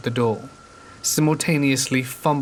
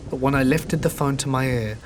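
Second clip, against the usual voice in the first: ordinary delivery, nothing marked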